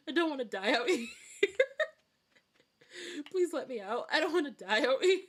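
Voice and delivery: high, loud mock pleading through laughter; laughing plea. Trapped player begging the game to let them out, laughing plea. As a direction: beg for your life while cracking up.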